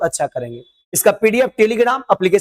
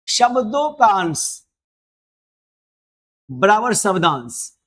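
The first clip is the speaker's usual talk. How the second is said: slow dictation; slower than usual. Shown as a demonstration. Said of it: "teacher dictating definition".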